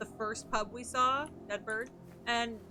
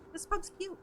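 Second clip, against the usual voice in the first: cute voice; 8 semitones higher than usual. Real, not acted. Cooing at the pub.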